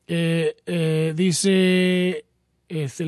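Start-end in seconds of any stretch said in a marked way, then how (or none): none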